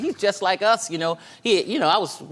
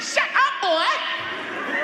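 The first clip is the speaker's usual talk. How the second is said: mama scolding; much slower than usual. Retold or imitated. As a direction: snap at him like a church mama. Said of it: "Now I said".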